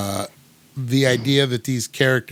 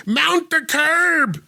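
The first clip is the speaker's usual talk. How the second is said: slow, high, drawn-out American cop line; Gary Oldman impression; much slower than usual. Podcast host imitating Gary Oldman's cop line, slow and high. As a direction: drag it out like a stiff movie cop. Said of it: "that's my Gary Oldman".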